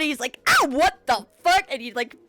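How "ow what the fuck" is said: higher, louder pained outburst; startled outrage. Acted. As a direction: yelp it as if just hit. Villain hit, turns around.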